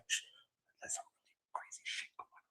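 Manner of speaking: hushed, unvoiced imitation of a secretive whisper; shush, then whisper it like a big secret; mock whispering. Host mimicking the actor's video confession, mock whispering. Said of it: "So he's whispering".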